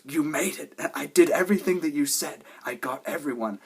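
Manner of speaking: high, loud, eager burst; excited. Retold or imitated. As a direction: blurt it out thrilled. Excited quoted reunion lines.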